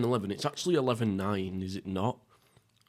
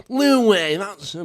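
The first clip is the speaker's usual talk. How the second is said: loud, high burst of mock outrage; 9 semitones higher, 9 dB louder, slower than usual. Real, not acted. Reacting to his own joke with a loud, high mock outrage. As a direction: yelp it like you caught yourself out.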